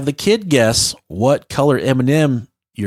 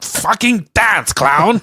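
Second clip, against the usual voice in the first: high, slow, drawn-out heckle; hammed-up order to perform; mock heckling demand; 9 semitones higher, much slower than usual. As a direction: imitate a pushy heckler, pitched way up. Acting out demanding strangers.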